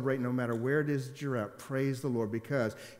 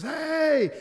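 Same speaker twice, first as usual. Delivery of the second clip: a big, much higher, loud retold "Hey!"; excited hey; 8 dB louder than usual. Pilot cousin greeting him off the plane, loud cheerful shout. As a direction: call out happily like an old friend.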